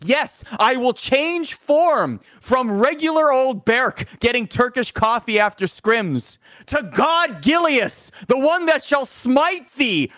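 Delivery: high, slow, booming godlike proclamation; mock biblical prophet. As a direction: preach it like a deity from the heavens. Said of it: "the one that parted the heavens above".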